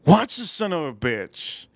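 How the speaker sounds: ordinary talk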